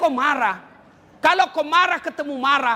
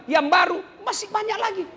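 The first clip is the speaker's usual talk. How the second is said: rising preaching; 8 semitones higher than usual. Acted. Preacher building to a climax.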